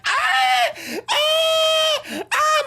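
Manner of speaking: hammed-up birthing shrieks; labor screams. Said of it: "this entire time she's screaming".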